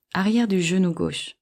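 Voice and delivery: ordinary delivery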